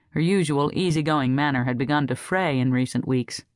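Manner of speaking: ordinary delivery, nothing marked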